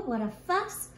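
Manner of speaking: usual speaking voice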